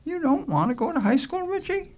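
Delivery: high, gentle lady's question; soft, sympathetic. Acted. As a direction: ask the boy with tender concern, pitched high. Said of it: "with a soft, sympathetic voice".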